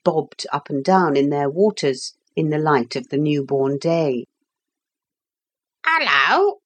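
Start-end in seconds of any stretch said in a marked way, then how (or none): 5.8-6.5 high parrot greeting, swooping up; high-pitched talking parrot; parrot's bird talk. A narrator's reading. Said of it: "said Polly in bird talk".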